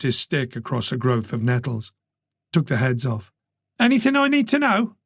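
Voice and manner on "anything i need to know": calling out. A narrator's reading. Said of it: "Charlie called across the tape".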